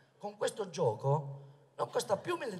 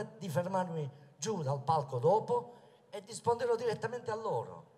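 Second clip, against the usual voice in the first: ordinary talk, nothing marked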